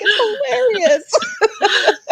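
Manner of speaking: giggling through the words; laughing it out, high; breaking into laughter mid-quote. Retold or imitated. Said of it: "And I'm like".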